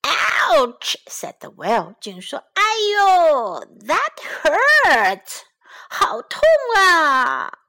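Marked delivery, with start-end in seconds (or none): 0.0-1.0 loud crying yelp; hurt well; pained yelp. Acted. The well is hit again. 2.5-7.6 high, loud, slow whimper of pain; wailing well; hurt crying. Acted.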